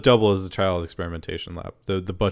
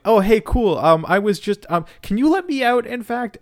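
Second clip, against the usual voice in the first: much higher, halting fake-casual voice; awkward Olivia; 12 semitones higher, 5 dB louder than usual. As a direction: play it cool, high-pitched and fumbling. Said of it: "And Olivia's like".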